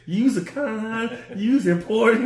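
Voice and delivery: high, slow hammed-up Southern accent; mock Southern accent. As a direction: drawl it out in a fake Southern accent. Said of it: "talk to the whole Southern accent".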